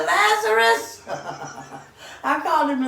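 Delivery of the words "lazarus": calling out. Retold or imitated. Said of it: "I said".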